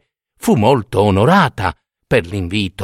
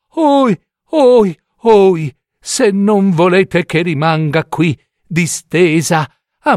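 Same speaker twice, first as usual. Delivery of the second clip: higher, feigned moaning and groaning; a sly fox faking pain to win pity; hammed-up whimpering lament; 6 semitones higher than usual.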